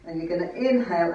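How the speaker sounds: normal talking voice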